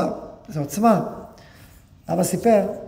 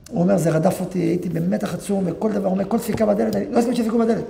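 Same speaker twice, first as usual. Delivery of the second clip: ordinary talk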